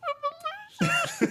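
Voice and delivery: very high whimpering squeal; mock sobbing. A drunk guy alone in bed, mock sobbing. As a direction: imitate crying yourself to sleep. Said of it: "cry myself to sleep".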